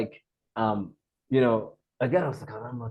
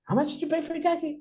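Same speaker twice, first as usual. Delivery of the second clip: high, quick questioning voice; curious host imitation; faster than usual. Homestay guys asking newcomer over dinner, high curious voice. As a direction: mimic the host's nosy question, pitched way up. Hosts quizzing him at dinner.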